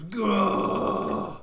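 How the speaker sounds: drawn-out roar of pain. Acted. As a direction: roar as if just slashed in the leg.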